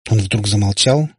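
ordinary delivery

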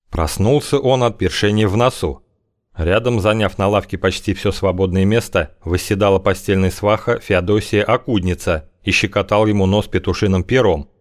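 usual speaking voice

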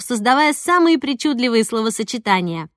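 plain reading voice

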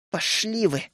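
понизив голос, сквозь зубы; sneering through teeth. A narrator's reading. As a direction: spit it out quietly through the teeth. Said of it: "процедил паренёк".

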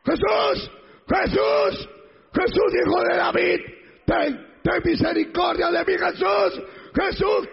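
high, urgent cry for mercy; desperate shouting. Acted. Blind man begging Jesús for mercy, desperate shouting. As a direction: shout and plead, pitched high. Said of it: "¿Por qué gritaba?".

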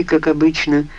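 ordinary delivery, nothing marked

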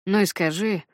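usual speaking voice

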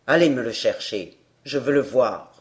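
gruff showman. A narrator's reading. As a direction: growl it like a hoarse showman.

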